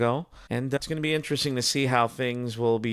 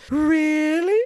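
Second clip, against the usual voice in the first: loud, very high rising cartoon squeal; Mel Blanc; 7 dB louder than usual. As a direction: imitate a bully suddenly gone sugary, pitched way up. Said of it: "Mel Blanc's delivery of".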